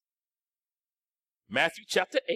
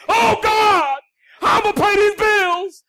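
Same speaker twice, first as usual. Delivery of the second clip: mock panicked prayer; 7 semitones higher, 5 dB louder than usual. Mocking a believer's panicked prayer.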